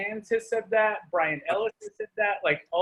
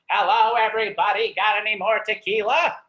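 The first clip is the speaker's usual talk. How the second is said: a lightly voiced talking birthmark, a bit louder; 5 semitones higher, 8 dB louder than usual. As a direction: give the birthmark a cheeky party voice. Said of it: "and I make it talk".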